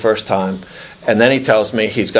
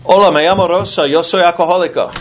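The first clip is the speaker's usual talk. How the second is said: higher, quick voice of a Spanish-speaking woman; Spanish woman on phone; 7 semitones higher, faster than usual. Retold or imitated. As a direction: play a woman introducing herself at an AA meeting by phone. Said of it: "some woman's voice who says".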